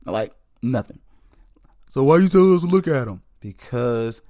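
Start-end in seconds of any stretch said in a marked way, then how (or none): 1.9-3.2 voicing a listener. Imagined listener question.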